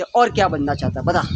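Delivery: put-on an old holy man hushing a crying bird; lightly voiced old sage.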